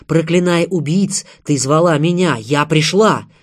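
loud, ringing climax; impassioned declaration. A narrator's reading. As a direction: proclaim it with full force. Maria's speech climaxes, answering Anna.

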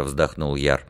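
usual speaking voice

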